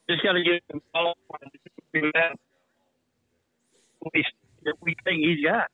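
breaking up on a bad phone line; choppy cutting-out call audio; buzzy glitchy call audio. Real, not acted. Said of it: "You're breaking up on me".

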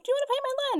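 high-pitched imitation of an offer; acted eager offer. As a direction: pitch it up as an invitation. Voicing circus man's offer.

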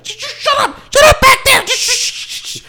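very high, screeching mock scold; mother scolding kids. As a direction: shriek at the kids to shut up, then shush them. Mother snapping at kids in back.

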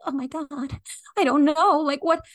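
high, rising mock panic; flustered new therapist spiraling; panicked rookie. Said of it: "you're like".

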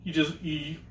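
ordinary delivery

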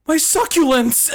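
high, loud yell of alarm; panicked yell. Retold or imitated. At work, hearing about rain, a panicked yell. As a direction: cry out for the plants in the rain. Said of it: "I once out loud yelled".

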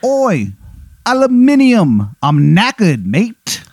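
high, exaggerated fake English accent; terrible English accent. Rival spouting British words in a terrible English accent. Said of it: "He's got a terrible English accent".

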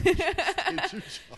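high, slow squeaky echo; mocking echo. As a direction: parrot it back in a silly squeak. Mock echo of advice, high.

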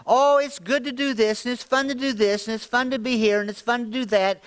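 high, sing-song voice of the world; mock tempting voice. Preacher voices the enemy's lure with mock cheerfulness. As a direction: tease it like a lure. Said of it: "a ploy of the enemy".

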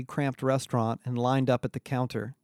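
plain reading voice

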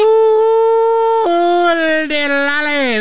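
long, high, loud "ooh" sliding down; celebratory whoop. Pretend. Celebratory whoop after a jingle.

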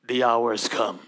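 deep, grave voice for Jesus; solemn Jesus voice. Acted. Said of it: "I'm like, come on dude".

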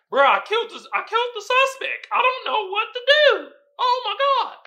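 high, loud panicked babble; panicked rookie. Retold or imitated. Rookie calling Denzel in a high panicked voice. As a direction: freak out like you just shot someone.

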